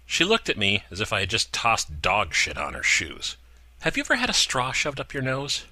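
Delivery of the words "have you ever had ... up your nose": higher snippy threat; Gina, snippy. A narrator's reading. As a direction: snap the question back.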